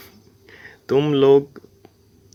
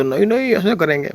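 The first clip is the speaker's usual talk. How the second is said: high, petulant imitation of him; lightly mocking high whine; mock whiny high voice; 7 semitones higher than usual. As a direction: mimic him insisting, pitched up. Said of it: "कहते".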